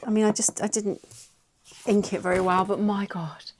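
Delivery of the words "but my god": ordinary talk, nothing marked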